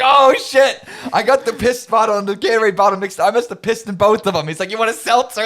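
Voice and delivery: high loud laughing Frank; hammed-up Frank impression.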